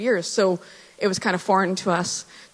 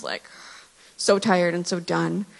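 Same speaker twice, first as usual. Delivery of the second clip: usual speaking voice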